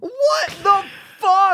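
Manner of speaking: very high, drawn-out loud squeal; shocked outburst. Real, not acted. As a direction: shriek it slowly in laughing disbelief. Still reacting to oil confession.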